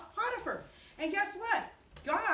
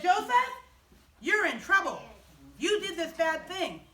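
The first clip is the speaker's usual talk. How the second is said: loud, higher angry scolding; angry master; 4 semitones higher, 5 dB louder than usual. Acted. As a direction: bark at Joseph like an angry master. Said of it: "Potiphar got angry".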